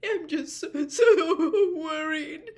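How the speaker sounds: high, slow, crying words; tearful. A narrator's reading. Missus Weasley admits her worry, crying. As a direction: let the tears spill over. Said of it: "tears spilling out of her eyes".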